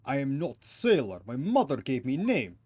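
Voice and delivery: put-on huffy Russian-accented sailor. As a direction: play a stubborn Russian crewman. Voices Russian sailor character.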